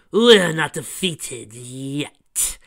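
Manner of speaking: slow, loud, villainous threat; menacing growl. Acted. Chrysalis threatening the heroes, slow and loud. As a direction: spit it out like a beaten queen refusing defeat. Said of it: "Queen Chrysalis growled".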